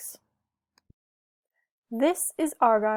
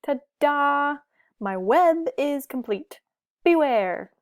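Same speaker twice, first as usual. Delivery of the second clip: louder, higher showy announcement; a fox playing at being a scary spider; proud cry; 5 semitones higher, 5 dB louder than usual. A narrator's reading. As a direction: play the spooky spider boastfully. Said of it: "cried Argyle".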